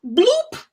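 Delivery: comic bloop sound. Retold or imitated. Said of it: "I'm saying bloop".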